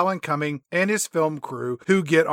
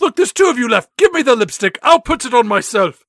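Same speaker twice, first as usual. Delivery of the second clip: louder hammed-up bargaining; mock desperate boss; 4 semitones higher, 5 dB louder, faster than usual. [name] to the last two, loud mock desperation.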